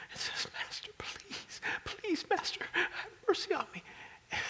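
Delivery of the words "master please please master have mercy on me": high, pleading begging voice; preacher playing the servant pleading with his master. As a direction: beg for mercy, voice up high.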